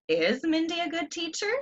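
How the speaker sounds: Teacher rising high like a question; question rise. Shown as a demonstration. Teacher reading an example as a question, rising. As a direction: lift "teacher" up into a clear question. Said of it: "That one's a question".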